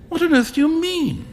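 high-pitched, incredulous question; astonished exclamation. A narrator's reading. Huey hears the beggar is rich, astonished exclamation. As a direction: burst out in bafflement, voice leaping up. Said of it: "exclaimed Huey".

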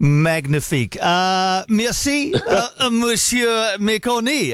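put-on playful French accent, last words slowed and laughing; a hammed-up French sign-off to a colleague; mock French accent. French accent thanking the co-host on air in a playful goodbye. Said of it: "I'm putting a French accent on this".

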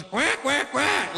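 high-pitched challenging quacks; imitated duck quack. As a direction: quack like a drake picking a fight. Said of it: "he goes".

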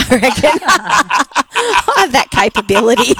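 slow, laughing through the words; laughing at her own joke, slowed down. Real, not acted. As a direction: giggle out the joke, drawn out. Joking about getting lost.

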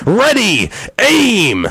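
very high, loud hammed-up command; captain's shout. Captain ordering the last execution, high loud mock command. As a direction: bellow the order, fully hammed. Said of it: "The captain shouted out".

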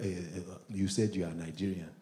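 put-on suspicious Nigerian-accented questioning. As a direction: test someone in a low Nigerian accent. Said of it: "Even Nigerians be testing me".

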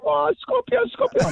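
high mock scream of alarm; mom screaming. Retelling mom's alarm at scorpion, high mock scream. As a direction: shriek it like a panicked mother. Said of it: "she was, like, screaming".

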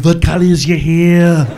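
heavy Austrian accent with mock-cool questions; Schwarzenegger impression. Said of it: "He can ask any question that sounds cool".

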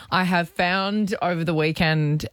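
ordinary talk, nothing marked

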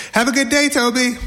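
high singsong mom send-off; cheery mom. Acted. Mom to son at the school bus, cheery. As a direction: call it out high like a doting mother.

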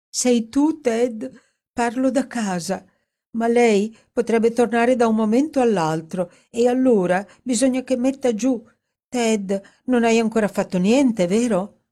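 high-pitched, plaintive and anxious; tragic, tearful. A narrator's reading. Dorothy phones Edward from home, tragic and tearful. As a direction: plead down the line with mild tearful drama. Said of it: "con una voce tragica e lacrimosa".

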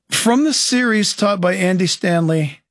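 normal talking voice

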